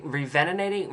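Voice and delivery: "revenovating" with muddled, extra syllables; stumbled pronunciation. Real, not acted. Speaker struggling with a word, "revenovating" mangled on the second attempt. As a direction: have another botched go at "revenovating". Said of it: "oh, can't say the word".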